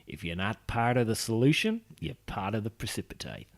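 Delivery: light Australian accent; reading it in an Aussie accent. Shown as a demonstration. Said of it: "I'm doing that in an Australian accent".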